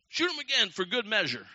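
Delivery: high, loud call to shoot; urgent guide's order. Retold or imitated. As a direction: shout it like a hunting guide egging someone on. Acting guide urging a shot.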